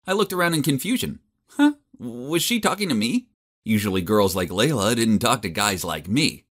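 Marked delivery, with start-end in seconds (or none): none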